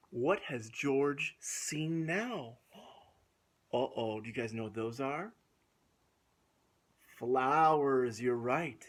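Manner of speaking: lively storybook reading with gasps; reading to children. A narrator's reading. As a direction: read it aloud to little kids. Reads picture book to children.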